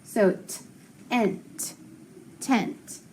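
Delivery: "t", "ent", then "tent", each part said apart with a short E; teacher blending the sounds for the class; sounding out tent. Shown as a demonstration. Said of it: "so it's gonna be short".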